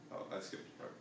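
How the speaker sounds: real whisper to herself; whispered aside. Real, not acted. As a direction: hush it as a quick aside. Reader's aside while reading.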